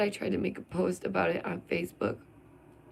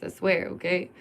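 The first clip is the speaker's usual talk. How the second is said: usual speaking voice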